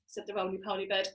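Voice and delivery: ordinary delivery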